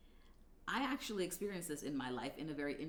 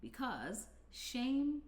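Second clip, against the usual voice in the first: drawn-out pun; 8 semitones higher, much slower than usual. Shown as a demonstration. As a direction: stretch the word shame slowly and high. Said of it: "I had to do it".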